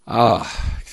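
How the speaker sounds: ordinary delivery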